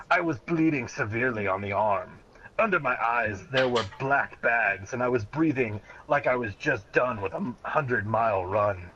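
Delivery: ordinary talk, nothing marked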